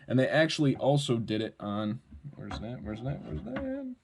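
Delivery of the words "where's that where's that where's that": soft, absent-minded repeated question; hushed, repeating till the last one lifts high; searching to himself. Real, not acted. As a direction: talk to yourself quietly while hunting for something.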